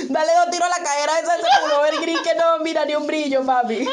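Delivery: quick, giggly mock command; bossing the gunman through laughter; laughing mock order. Telling the robber whom to shoot as a joke, laughing mock order.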